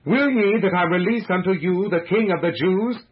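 high, projected appeal to a crowd; loud public question. A narrator's reading. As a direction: call the question out over a mob. Pilate calls out to the crowd.

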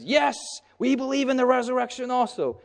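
high mock voice of a cheering faction; eager Pharisees. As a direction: squeal it like an eager partisan.

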